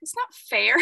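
a hint of a sulky child; mild childish whine; whiny inner child. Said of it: "my inner child is like".

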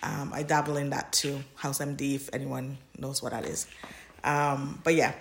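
usual speaking voice

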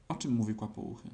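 ordinary delivery

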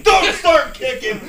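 high, slow, booming mock order; hammed-up screamed warning; screaming instructor. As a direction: bellow it like an alarmed instructor. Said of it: "the instructor's screaming at me about kicking".

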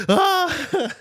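loud high startled scream. Retold or imitated. Retelling the convict waking up, loud high scream. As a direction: shriek as if jolted awake. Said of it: "he's like".

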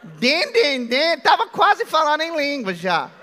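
amused laughing retelling. Real, not acted. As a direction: chuckle over the mangled name. Laughing at mangled name.

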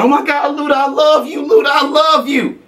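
high squealing fangirl. Retold or imitated. Friend mocking [name] crying over [name], high squeal. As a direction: gush like a starstruck fan. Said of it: "He said [name] got all geeked, started crying".

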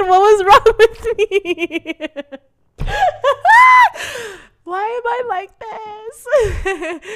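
very high, drawn-out squeal through laughter; hysterical laughing wail. Real, not acted. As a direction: wail at yourself while cracking up. Cracking up at own confession.

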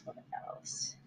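breathy whisper, thinking aloud; whispered to herself. Real, not acted. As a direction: mutter it under your breath.

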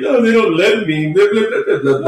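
high whiny mock sob voice; mock whining. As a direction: whine it like a crybaby. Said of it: "on this fucking crying period".

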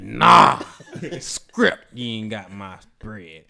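loud, low-pitched mock refusal; booming, dismissive no; emphatic refusal. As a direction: shout it down as the robber. Acts robber refusing the man.

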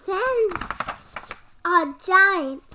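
loud, rising dramatic reveal; dramatic announcement. Acted. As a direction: boom out the big reveal.

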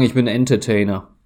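usual speaking voice

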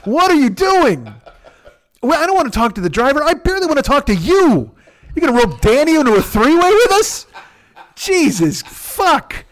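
high, incredulous comic tirade; exasperated rant. Acted. Acted exasperated rant at his friend.

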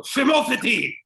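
high, loud barked Phimotheti; aggressive shout. Pretend. Parent shouting at a noisy kid, aggressive yell. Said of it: "That sounds aggressive and I like it".

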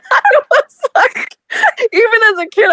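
high, breaking with laughter; laughing so hard it sounds like crying; tearful laughter. Real, not acted. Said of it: "This is my last resort".

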